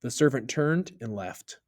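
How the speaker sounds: plain reading voice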